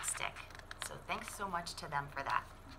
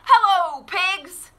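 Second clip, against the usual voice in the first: high, bright greeting as the duck; higher, louder duck voice; cheerful duck; 19 dB louder, much slower than usual. Acted. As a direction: call out hello as a friendly duck. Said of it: "said Duck".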